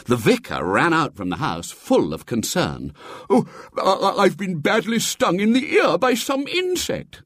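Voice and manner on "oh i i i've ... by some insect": put-on high, stammering old cleric in pain; reading-aloud bishop voice, high and flustered; fussy bishop. A narrator's reading. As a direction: stammer it out as a pompous stung bishop. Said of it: "said the bishop".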